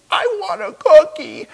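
high, loud mock wail; crying toddler. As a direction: whine it like a tearful two-year-old. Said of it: "Why are you crying?".

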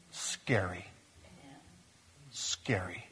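low, ominous whisper; very soft, breath-only word; hushed warning. Real, not acted. Hushed warning after a long pause.

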